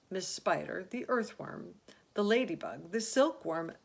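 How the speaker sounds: ordinary delivery, nothing marked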